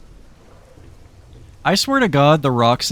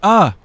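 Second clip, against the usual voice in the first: sudden loud high yelp; startled yelp; 9 semitones higher, 9 dB louder than usual. Real, not acted. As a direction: yelp as the boat breaks down.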